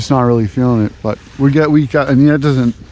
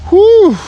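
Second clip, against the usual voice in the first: exertion whew; 6 dB louder than usual. Real, not acted. After digging the post hole.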